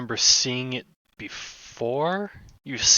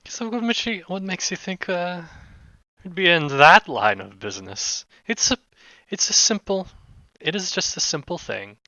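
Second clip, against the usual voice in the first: higher-pitched, evasive shady contact; cagey job giver; 8 semitones higher than usual. Acted. Job giver brushing off a rogue's question, high and cagey. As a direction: voice a go-between downplaying the job, pitched up.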